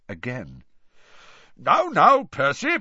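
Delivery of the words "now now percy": high, loud jovial official; Ludo Bagman. A narrator's reading. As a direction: soothe a fussy colleague cheerily. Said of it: "said Ludo Bagman".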